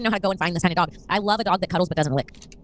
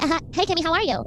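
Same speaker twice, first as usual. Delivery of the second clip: a light, higher valley girl voice, mocking her co-host; valley girl impression; 9 semitones higher than usual. Teasing her co-host about her new voice, light valley girl mimicry. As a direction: tease her friend with a breezy valley girl chirp. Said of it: "you sound like a valley girl".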